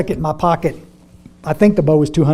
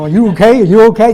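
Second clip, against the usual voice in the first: louder, higher, panicked waiter checking in; alarmed waiter; 5 semitones higher, 10 dB louder than usual. Retold or imitated. The waiter, seeing them both crying, asks in loud alarm. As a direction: act out a worried waiter rushing over.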